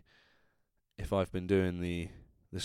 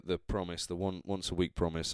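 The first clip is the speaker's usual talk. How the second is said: ordinary delivery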